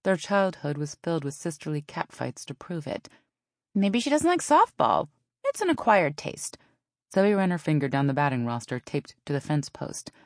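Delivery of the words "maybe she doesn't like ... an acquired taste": sarcastic sister. A narrator's reading. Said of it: "her sister's sarcasm".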